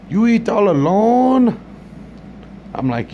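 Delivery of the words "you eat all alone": high, slow put-on voice of the man at the counter; mock accented waiter. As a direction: drag out the question in a high accented voice. Comedian voices restaurant worker.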